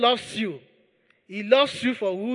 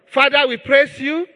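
loud, driving prayer at full force; fervent preaching; 3 semitones higher, 6 dB louder than usual. Acted. Preacher leading church in thanks to God, fervent full-voiced prayer. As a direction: pour it out over the congregation.

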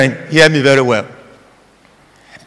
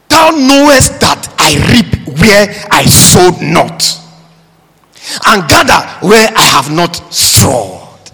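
slow, drawn-out declamation of scripture; forceful scripture reading; 5 semitones higher, slower than usual. Acted. Preacher reads the master's rebuke to the congregation, slow and forceful.

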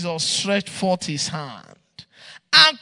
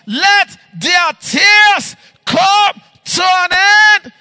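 slow, loud and higher, building; climactic shouted prayer; 9 semitones higher, 18 dB louder, much slower than usual. Real, not acted. Pastor begging God for barren women, slow rising shout. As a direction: drag each word out and cry it louder.

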